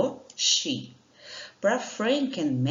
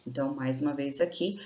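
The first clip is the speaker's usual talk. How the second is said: ordinary talk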